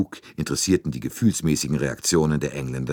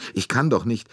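higher-pitched, distressed refusal; kurz vorm Zusammenbruch; 8 semitones higher, 3 dB louder than usual. A narrator's reading. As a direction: plead helplessly, close to breaking down. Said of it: "plötzlich brach sie zusammen". (verzweifelt, höher, dem Zusammenbruch nahe)

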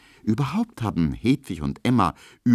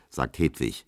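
usual speaking voice

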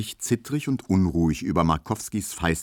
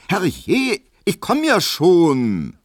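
high, loud and slow call; shouting; 11 semitones higher, 7 dB louder, much slower than usual. Acted. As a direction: yell it toward the door. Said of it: "schrie Hedwig drinnen". (laut rufend, hoch und gedehnt)

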